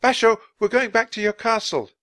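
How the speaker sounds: put-on high, loud girl's call; calling out over the distance; shouted. A narrator's reading. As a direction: shout it across to him. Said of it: "She shouted to Basho".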